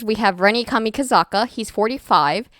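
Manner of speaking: ordinary talk, nothing marked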